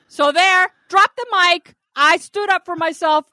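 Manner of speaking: triumphant boast. Real, not acted.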